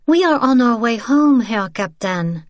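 lightly voiced young woman, curt. Isabel brushing off the captain, cool and curt. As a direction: end the conversation politely but coldly.

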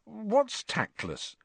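slow, high, puzzled question; baffled and drawn out; bewildered. A narrator's reading. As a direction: ask it slowly, at a loss.

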